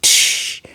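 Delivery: short hissy "tsh" sound effect; imitated mechanical click. Reviewer showing how the gun device reloads, imitated tsh noise.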